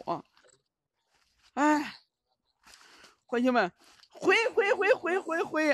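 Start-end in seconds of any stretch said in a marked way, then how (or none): 4.1-5.7 much higher repeated calls; high calls to retreat. Real, not acted. Streamer pulled back once more, high repeated calls. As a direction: call out again and again.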